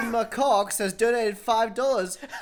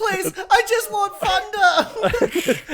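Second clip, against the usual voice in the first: whiny pleading voice; 10 semitones higher, 6 dB louder, slower than usual. Retold or imitated. Mocking voice in game story.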